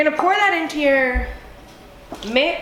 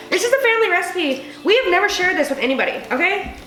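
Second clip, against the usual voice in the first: excited boast; 7 semitones higher, faster than usual. Real, not acted. Tipsy boasting family recipe.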